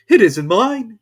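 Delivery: high, loud apologetic voice; a nervous hatter excusing himself; Hatter's voice. A narrator's reading. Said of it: "said the Hatter".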